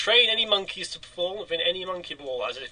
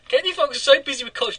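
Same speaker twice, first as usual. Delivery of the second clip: mock accusing; 8 semitones higher than usual. Mock accusing Katie Foxy.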